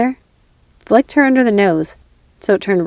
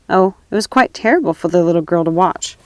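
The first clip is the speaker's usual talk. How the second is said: usual speaking voice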